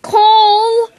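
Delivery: sharp high yell of the name; shout. Acted. At his friend who scared the horse, a yell. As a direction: yell at him in alarm.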